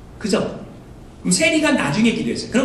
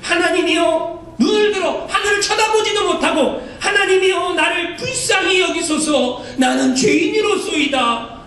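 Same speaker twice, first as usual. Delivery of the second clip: pleading, felt prayer; 7 semitones higher than usual. Shown as a demonstration. 같은 구절을 그냥 읽지 않고 세리의 마음으로 간절하게 기도하듯. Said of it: "제가 하는 얘기를 잘 들어보세요".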